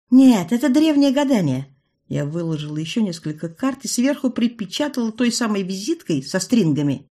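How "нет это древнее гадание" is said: loud, high insistence; insistent. A narrator's reading. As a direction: protest loudly. Heroine insisting.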